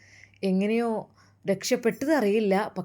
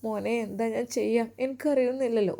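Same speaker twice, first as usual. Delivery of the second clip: high, soft tearful lament; acted weeping; crying mother; 5 semitones higher, 4 dB quieter than usual. A narrator's reading. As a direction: pour out a mother's helpless grief. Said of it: "നിങ്ങൾ കരയല്ലേ".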